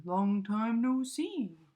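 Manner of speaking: slow, loud, hearty hare voice; hare greeting. A narrator's reading. Hare greeting his old friend mouse, slow and hearty. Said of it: "says Hare".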